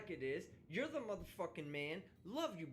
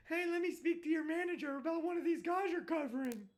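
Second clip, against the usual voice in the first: higher, louder entitled complaining voice; mock Karen; 9 semitones higher, 5 dB louder than usual. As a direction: play the customer demanding the manager. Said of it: "run to the sponsors and go".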